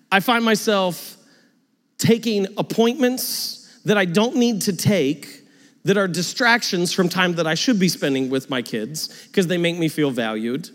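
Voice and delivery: usual speaking voice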